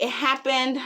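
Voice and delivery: ordinary talk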